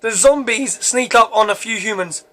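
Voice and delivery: ordinary delivery, nothing marked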